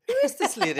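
a shrieking double take at a stranger's voice; stunned surprise. Retold or imitated. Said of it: "I was like".

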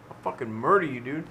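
playful hushed threat; mock threat. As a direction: threaten quietly through the door.

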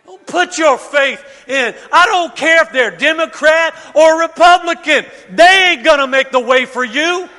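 loud shouting preacher, high and driving; full-force preaching. Acted. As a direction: build it to a shout for the congregation.

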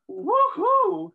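high, loud cheer; excited whoop. Retold or imitated. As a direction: let out a happy woohoo. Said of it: "And I'm going".

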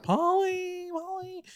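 high, drawn-out call across the swamp; long high call, a mock search cry. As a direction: call her name like a searcher far off. Search party calling out.

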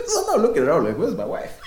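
high, cracking up through the words; laughing. Real, not acted. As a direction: tell it through real laughter. Cracking up telling his punchline.

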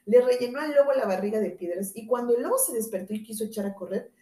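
ordinary delivery, nothing marked

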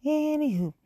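anywho sung out high and loud; playful high sing-song anywho. Real, not acted. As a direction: sing out the topic change brightly. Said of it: "But anywho".

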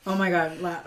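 usual speaking voice

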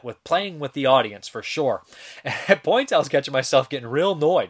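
normal talking voice

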